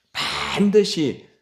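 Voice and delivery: 반드시 drawn out long and pitched high; slow, high, insistent 반드시; emphatic stress. Real, not acted. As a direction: stretch the first syllable of 반드시 for emphasis. Preacher stresses God's promise word.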